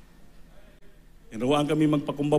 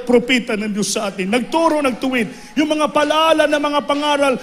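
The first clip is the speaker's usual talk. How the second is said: fiery preaching; 5 semitones higher, 5 dB louder than usual. Acted. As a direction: preach it to the congregation with fire. Preaching testimony to church. (masigasig na pangangaral nang malakas)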